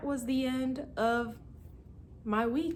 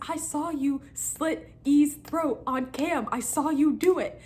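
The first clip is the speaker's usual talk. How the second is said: mild accusing; 7 semitones higher, 4 dB louder than usual. Retold or imitated. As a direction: lay out the accusation deliberately. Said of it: "we're just arguing".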